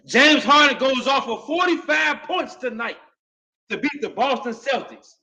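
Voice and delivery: high, loud celebration call; hype shout. Real, not acted. Fan shouting the game result to his viewers. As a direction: shout the headline like a hype man.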